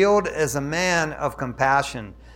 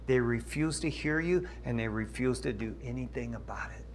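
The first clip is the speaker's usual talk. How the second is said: a hushed whisper, grieving over their refusal; whispered lament; 6 semitones lower, 9 dB quieter than usual. Real, not acted. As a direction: drop to a whisper as if sharing a sorrow. Preacher drops to a hush.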